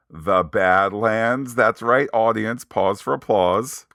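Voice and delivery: loud, grand applause line; hammed-up announcer milking applause; mock showman announcement. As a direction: play it big to an imagined crowd. Playing to an imagined audience.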